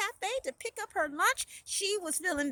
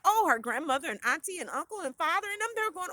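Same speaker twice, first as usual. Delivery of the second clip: ordinary delivery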